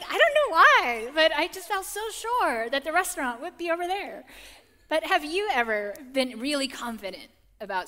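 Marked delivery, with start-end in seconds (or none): 0.0-4.3 high, loud comic self-defence; sheepish protest. Retold or imitated. Wife explaining her wrong hunch to husband, comically sheepish. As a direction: plead your case in a raised, amused pitch.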